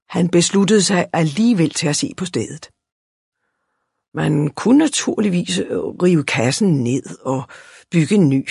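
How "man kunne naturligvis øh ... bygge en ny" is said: ordinary delivery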